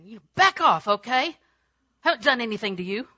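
loud, defensive mock outburst; hollering back. Someone hollering back at an angry person, acted. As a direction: snap back loudly, acting offended. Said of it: "when they're hollering at you".

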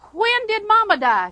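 loud, high, burst-out question; blurted question. Retold or imitated. Preacher finally asking the grieving lady about Mama, blurted question. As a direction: let the held-back question burst out.

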